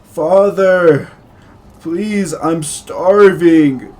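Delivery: loud, slow, high cry of hunger; desperate scream. Acted. Young man begging his father from the basement, screaming. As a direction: howl it up from under the floor. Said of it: "a young man cries out, uh, screaming".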